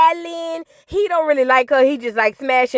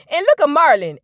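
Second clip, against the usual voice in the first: ordinary delivery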